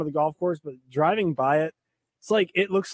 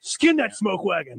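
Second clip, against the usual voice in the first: tough gunslinger drawl; slower than usual. Acted. Quoting Val Kilmer line.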